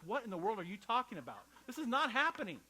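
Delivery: high, loud, half-laughing disbelief; stunned disbelief. Retold or imitated. Mary answering the angel, shocked and refusing to believe it. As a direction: react like someone hearing impossible news. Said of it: "she's like".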